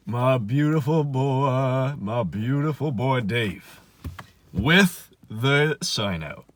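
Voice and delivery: slow, adoring drawn-out praise; doting. Retold or imitated. Friend greets buddy with the sign-out sheet, slow and adoring. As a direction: savour each word like greeting a hero.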